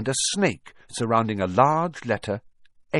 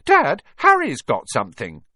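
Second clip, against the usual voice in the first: put-on high, loud boy telling on someone; sudden tattling; 7 semitones higher, 8 dB louder than usual. A narrator's reading. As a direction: alert Dad urgently. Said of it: "said Dudley suddenly".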